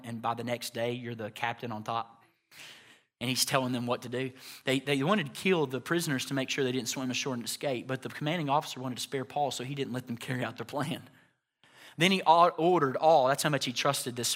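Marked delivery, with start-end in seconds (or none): none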